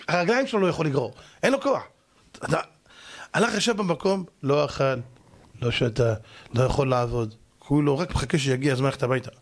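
usual speaking voice

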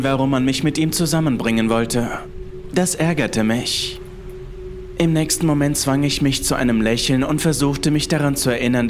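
ordinary delivery, nothing marked

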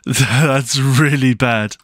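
slow, drawn-out laughing groan; laughing dismay. Real, not acted. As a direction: laugh it off in disbelief. Laughing at the danger.

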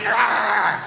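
high drawn-out dog cry; imitated dog howl. Dogs being put on the sled, imitated frantic howl. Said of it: "they put 'em on the sled".

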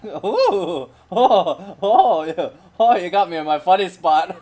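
laughing while tickled; squealing through helpless laughter; being tickled. Real, not acted. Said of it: "You're tickling me!".

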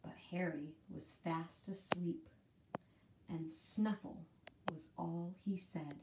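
soft hushed whisper; whispered ending. A narrator's reading. Storyteller whispering the ending as Harry falls asleep. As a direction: whisper so as not to wake him.

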